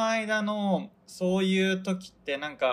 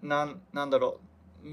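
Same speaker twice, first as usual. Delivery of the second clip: ordinary delivery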